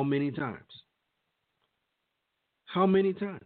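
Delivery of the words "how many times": higher and louder, real anger; exasperated outcry. Real, not acted. Vlogger demanding answers about police killings, in a higher, louder outcry. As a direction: cry it out, fed up.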